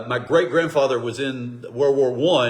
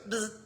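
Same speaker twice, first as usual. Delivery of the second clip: tiny limp buzzing noise; a weak little zap; mock buzz; 8 semitones higher, 13 dB quieter than usual. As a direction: feeble pretend zap. Imitates a feeble divine answer.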